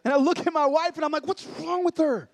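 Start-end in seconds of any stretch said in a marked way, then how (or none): 1.2-2.3 high, drawn-out bewildered question; exasperated, incredulous dad; baffled alarm. Retold or imitated. Dad to his wife about weeping daughter, baffled alarm.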